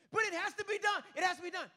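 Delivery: loud, high-pitched objection; frantic protest. Retold or imitated. Stressed man arguing back at God, frantic and loud. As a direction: protest at the top of your voice.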